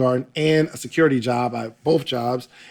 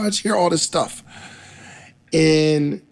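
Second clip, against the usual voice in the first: ordinary delivery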